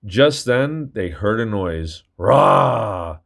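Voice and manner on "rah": a big rumbling snore, louder; growled snore sound; loud snore roar. Acted. Norman snoring loudly.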